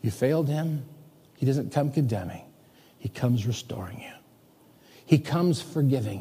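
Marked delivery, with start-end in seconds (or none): 3.0-4.1 breathy hushed whisper, intimate. Real, not acted. Pastor whispering reassurance to his listeners, hushed. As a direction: let it drop to a whisper of comfort.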